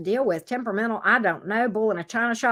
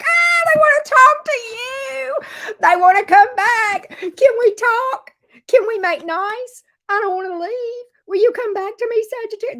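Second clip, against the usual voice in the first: pleading ex; 12 semitones higher, 7 dB louder than usual. Retold or imitated. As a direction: beg them to take you back, high and loud.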